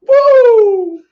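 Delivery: high, loud drawn-out woo; piercing, sustained "Woo!"; Ric Flair "Woo!". Retold or imitated. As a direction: let out a long wrestler's whoop. Ric Flair catchphrase after quoting him.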